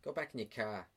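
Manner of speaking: subdued Boston grumble; Boston accent. Acted. Bartender griping at customers under cover, in a Boston accent. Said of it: "in a Boston accent".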